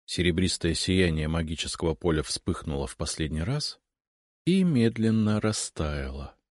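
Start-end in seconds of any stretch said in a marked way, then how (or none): none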